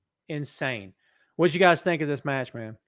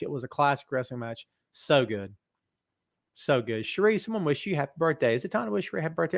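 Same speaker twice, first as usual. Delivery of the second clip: normal talking voice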